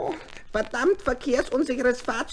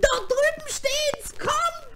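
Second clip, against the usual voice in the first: much higher, slow excited call; eager call; 12 semitones higher, slower than usual. Acted. Nephew calling brothers to the new car, excited. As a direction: call them over eagerly.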